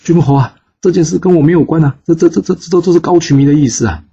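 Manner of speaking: high, fast, stammering plea; kneeling noble begs the duke, panicked and stuttering; terrified pleading. Acted. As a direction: beg for your life, tripping over the words. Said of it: "吓得跪下来说".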